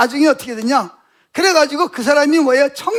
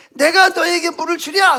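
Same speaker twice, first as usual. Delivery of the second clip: higher-pitched angry challenge; mock Moses losing his temper, pitch raised; retold hot temper; 4 semitones higher than usual. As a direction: imitate an exasperated outburst. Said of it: "혈기를 막 부리면서".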